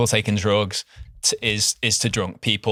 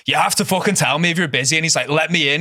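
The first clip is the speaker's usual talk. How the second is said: angry demand; 7 semitones higher, faster than usual. Retold or imitated. Guy banging the door.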